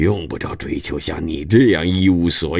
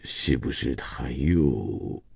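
softer, very slow and hesitant; lowered embarrassed voice; 4 semitones lower, 8 dB quieter, much slower than usual. A narrator's reading. Grandfather asks his daughter-in-law if her husband is drinking, softer and hesitant. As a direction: drop the voice, ashamed to ask. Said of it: "很不好意思地放低了声音".

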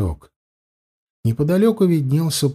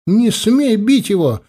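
much higher, urgent cry; cry of protest; 10 semitones higher, 3 dB louder than usual. A narrator's reading. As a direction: cry out sharply to stop a blow. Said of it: "раздался крик Метты". (возмущённый высокий крик)

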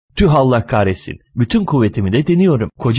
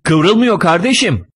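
higher-pitched, irritated exchange; exasperated bickering; 8 semitones higher, slower than usual. Acted. Driver and passenger quarrel over the car door, exasperated bickering. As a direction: bicker over a stuck handle.

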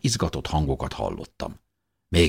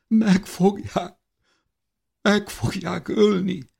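much higher, slow, halting through tears; tearful stammer; slower than usual. Acted. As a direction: falter as if sobbing, voice breaking. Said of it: "rebegte sírva elcsukló hangon". (sírva, elcsukló hangon rebegve)

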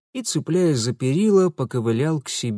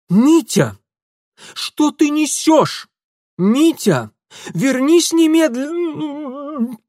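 high, loud furious aunt; angry shouting; 13 semitones higher, 7 dB louder, much slower than usual. Acted. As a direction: yell after him in anger. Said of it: "Гневные выкрики тётушки".

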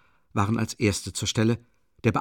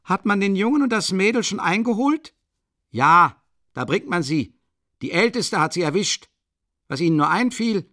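voices from the crowd; 10 semitones higher, 5 dB louder than usual. A narrator's reading. Bystanders calling questions.

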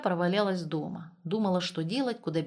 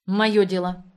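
firm, louder cut-off; curt retort; 7 dB louder, slower than usual. A narrator's reading. Наташка cutting off Светка's jibe about her lover, curt. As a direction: shut the subject down curtly. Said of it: "отрезала Наташка".

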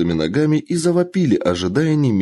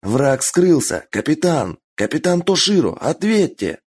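urgent calling; 7 semitones higher than usual. Acted. Calling captain over radio.

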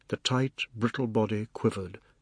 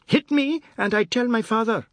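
put-on very high, loud child's voice; defiant boy's threat; 12 semitones higher, 7 dB louder than usual. Anand warns the man seizing him, high defiant threat.

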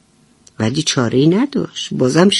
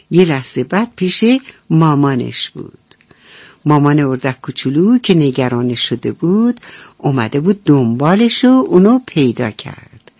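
plain reading voice

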